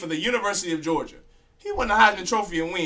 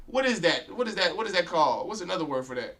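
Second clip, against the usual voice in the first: ordinary talk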